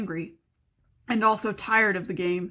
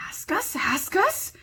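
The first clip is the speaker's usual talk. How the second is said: high hissing whisper of a hungry creature; Gollum voice; 9 semitones higher, slower than usual. Acted. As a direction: whisper it greedily, like a slimy cave creature. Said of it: "said Gollum".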